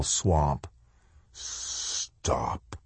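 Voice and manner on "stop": hissed, unvoiced whisper. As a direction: hiss it like a fuse. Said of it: "The shadow soldier's whisper".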